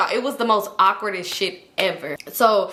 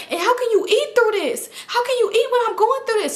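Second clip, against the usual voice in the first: high-pitched mock meltdown; high, outraged wail in a friend's voice; retold yelling; 14 semitones higher than usual. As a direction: imitate a friend crashing out, pitched way up. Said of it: "imagine you yelling and crashing out".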